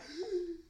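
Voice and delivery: high gasp of shock; sharp gasp. Retold or imitated. Retelling a shocked reaction to the episode, sharp gasp. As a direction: suck in a startled breath.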